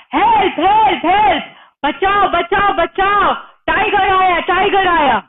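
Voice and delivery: high, urgent mock cries for help; shouting for help. A narrator's reading. Feku tricks the village with a tiger alarm, high shouting. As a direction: call out a false alarm in a high voice. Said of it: "he started shouting".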